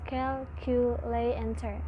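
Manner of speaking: "cal, cu, le, and ter" with a pause after each piece; syllable by syllable. Shown as a demonstration. Student teaching the class, splitting "cal, cu, le, and ter" into separate syllables. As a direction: chop calculator into its syllables. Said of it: "Calculator has four syllables".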